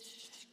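long quiet "shh"; acted, drawn-out shushing; soft silencing shush. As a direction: hush the voices softly. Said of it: "callen esas voces".

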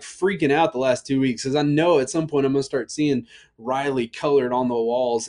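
ordinary delivery

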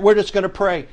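normal talking voice

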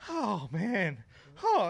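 hammed-up moan from next door; imitating neighbour's moan. Retelling noises through the wall, mock moan. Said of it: "And he goes".

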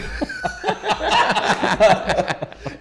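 real high laughing fit. Real, not acted. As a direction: burst of high, loud laughter. Laughs at own story.

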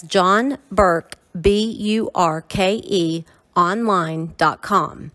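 slow, letter-by-letter spelling; slow spelled-out address. Shown as a demonstration. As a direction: spell it out slowly and clearly. Repeats address slowly, spelled.

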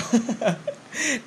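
high giggling laughter; real laugh. Real, not acted. Vlogger alone in her room, giggling high and real. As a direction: break into a giggle you can't hold back. Laughs, says natatawa tuloy ako.

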